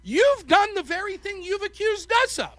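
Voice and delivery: high-pitched righteous indictment; mock accusation. Debater turning the charge on opponents, mock righteous. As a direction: accuse them with feigned outrage.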